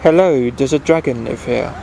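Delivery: plain reading voice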